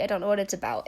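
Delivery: ordinary delivery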